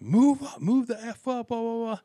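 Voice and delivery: high, strained mock shout, trailing into blahs; imitated irate yelling. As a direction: mimic a driver going off. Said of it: "this guy goes off on me".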